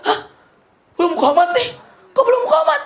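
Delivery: very high, startled questions; astonished. Retold or imitated. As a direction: play it up as comic disbelief. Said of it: "Kaget dia". (kaget tak percaya, nada melengking tinggi)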